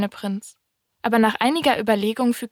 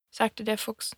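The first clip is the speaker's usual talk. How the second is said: plain reading voice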